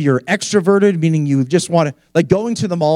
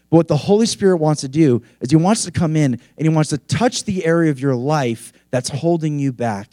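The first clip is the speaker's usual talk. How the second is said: ordinary delivery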